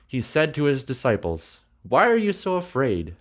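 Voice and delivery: animated reading aloud with lifted quotes; expressive scripture reading. A narrator's reading. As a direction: read scripture like a gripping story. Reads the Bible passage aloud.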